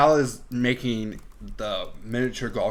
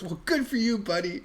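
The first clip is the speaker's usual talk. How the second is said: high mock cheer; 8 semitones higher, 4 dB quieter than usual. Boss voiced in retelling.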